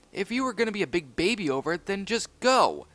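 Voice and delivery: a pup telling his friend to leave; angry retort. Acted. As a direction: snap back at him. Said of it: "said Zuma angrily".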